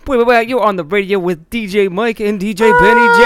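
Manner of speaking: loud, high radio announcer hype; radio shock jock intro. Pretend. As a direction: boom it like a morning DJ. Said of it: "a cappella radio shock jock intro".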